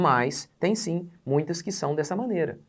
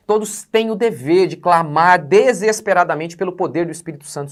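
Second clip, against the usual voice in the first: preaching at full force; 4 semitones higher, 9 dB louder than usual. Acted. Loud emphatic teaching on fire.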